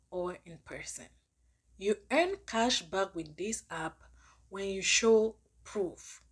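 ordinary talk, nothing marked

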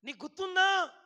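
high, loud shout to be heard. Retold or imitated. Mother shouting after her son in the street, loud and high. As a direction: yell it out so he turns around. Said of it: "గట్టిగా అరిచి చెప్పిన".

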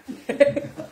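short real burst of laughter; helpless little laugh; amused laugh. Real, not acted. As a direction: crack up briefly. Cracks up at silly customer.

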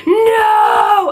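high, loud cry of protest; mock anguished no. Child fan seeing her hero turn evil, loud anguished no. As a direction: shout no as if betrayed. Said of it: "I was just like".

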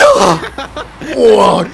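loud, high celebratory yell; excited cheer. Real, not acted. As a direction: cheer at the top of your voice. Jubelt über Tor. (lauter, hoher Jubelschrei)

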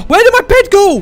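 high, loud alarmed question. Real, not acted. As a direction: cry out in dismay. Bed vanished.

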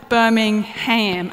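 "Birmingham" said very slowly, trying the local way; carefully sounding out "Birmingham" with a full "ham". Shown as a demonstration. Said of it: "Am I getting this right?".

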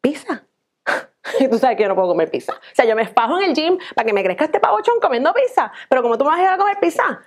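indignant fast rant; outraged at the pizza idea; quick indignant complaint, rising in pitch; offended girlfriend. Acted.

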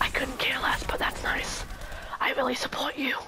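soft unvoiced whisper. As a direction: whisper back. Answers the whisper in kind.

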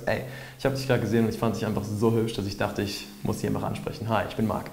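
friendly and easy, soft and quick; warm, trusting. Shown as a demonstration. Approaching a stranger he finds pretty, warm and trusting. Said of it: "vertrauensvoll und warm und sympathisch".

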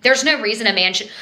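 normal talking voice

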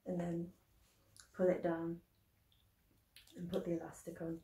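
muffled behind cloth mask; speaking with mouth covered; through a face mask. Real, not acted. Seen 0: Cloth mask held then worn over mouth, removed by 15.6.

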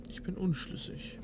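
normal talking voice

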